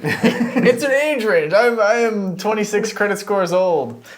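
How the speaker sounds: high, drawn-out goofy declaration through laughter; laughing mock announcement. Co-host mocking his own near slip, laughing and hamming it up. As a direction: play up the silly slip, loud and high.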